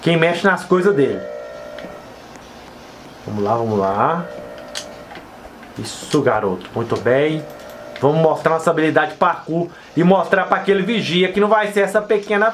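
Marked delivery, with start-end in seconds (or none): 3.3-7.4 deep self-encouraging coach; low, steady pep talk to himself. Pretend. Cheering on his game character.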